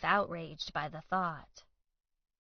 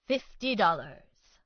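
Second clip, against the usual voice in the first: proud; 6 dB louder, slower than usual. A narrator's reading. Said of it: "Baldy said proudly".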